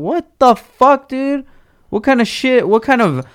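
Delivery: very high-pitched, incredulous complaint; exasperated outburst. Acted. Fan duped by the album delay, exasperated outburst. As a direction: let it fly up high in outrage.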